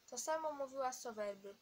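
ordinary delivery, nothing marked

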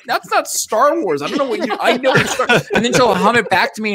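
usual speaking voice

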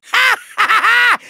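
long, high villain cackle; evil cackle. Acted. Villain gloats over a trick up his sleeve, maniacal laugh. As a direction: cackle triumphantly, drawn right out.

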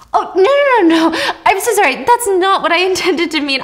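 high, nervous laughing apology. Retold or imitated. Teacher backpedals nervously.

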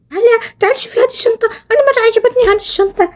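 put-on high, thrilled kid voice; delighted child. Girl calls her sister over to see the bag, high and delighted. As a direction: show it off like an excited little girl.

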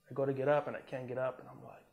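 ordinary talk

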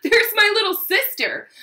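high, loud taunting call; mock holler. A narrator's reading. Brother spots his little sister at school, hollering. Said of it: "he hollered".

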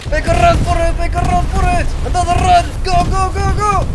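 high loud panicked yelling; being chased. Acted. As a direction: yell orders while fleeing. Fleeing under fire.

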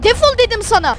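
usual speaking voice

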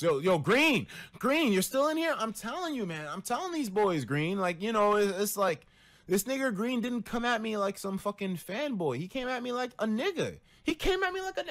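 high, loud hype calling out to a friend; calling to Green in chat, loud and hyped; excited. Real, not acted. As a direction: shout across the room all fired up.

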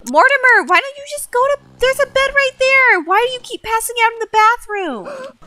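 high, exasperated scolding of a sim; exasperated high call. Real, not acted. Gamer scolding a sim who keeps passing out, high and exasperated.

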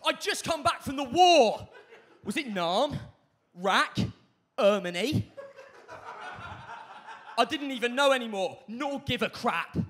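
very loud, overwrought student acting; hammed-up drama monologue. Teenager performs war trauma monologue, loud ham acting. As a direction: declaim it like a school drama exam. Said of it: "perfect G C S E drama monologue".

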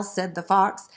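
ordinary delivery

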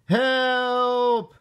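high, long wailed call; cry for help. A narrator's reading. Froggy thinks he is drowning, high cry for help. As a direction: wail it out as one held high note. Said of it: "cried Froggy".

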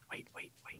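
urgent whisper. Real, not acted. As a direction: hush it, barely voiced. Sneaking up on someone.

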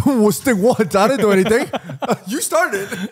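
high, giggly protest through laughter; laughing protest. Real, not acted. Podcaster teased by his co-host, protesting through laughter. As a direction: deny it while cracking up.

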